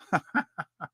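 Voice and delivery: a quick soft chuckle of disbelief; amused snort at the wild claim; short laugh. Real, not acted. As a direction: let a little laugh slip. Laughs at economic warfare line.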